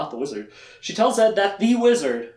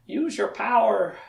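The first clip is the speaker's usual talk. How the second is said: high, slow, breathy-soft plea; dying Char; 6 semitones higher, 4 dB quieter, slower than usual. Retold or imitated. Recapping the show, speaker voices Char begging Kaylin softly. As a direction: softly beg like a dying spirit. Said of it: "and Char's like".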